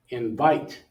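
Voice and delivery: inVITE, weight on the second syllable; second-syllable stress. Shown as a demonstration. Teacher sorts words by stress, second syllable of Invite stressed. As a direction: lean into the last syllable of Invite.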